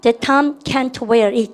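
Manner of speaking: very slow, word by word; slow drill read. Shown as a demonstration. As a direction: spell it out slowly for students. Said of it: "시작". (아주 천천히 따라 읽기 시범)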